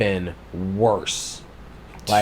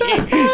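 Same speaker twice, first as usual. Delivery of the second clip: high shrieking laugh; 4 dB louder than usual. Real, not acted. Cracking up at memory.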